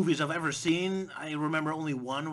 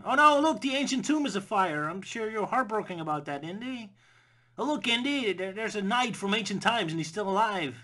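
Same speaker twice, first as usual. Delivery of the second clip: much higher, hammed-up outrage; mock him with fake excitement; sarcastic mock alarm; 5 semitones higher than usual. Narrator taunting Indy over ruined sites, mock alarm.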